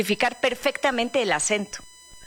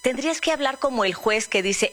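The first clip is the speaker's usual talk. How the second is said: ordinary talk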